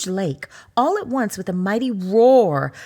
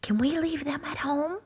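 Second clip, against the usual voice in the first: whispered; 6 semitones higher, 5 dB quieter than usual. Acted. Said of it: "and whispered".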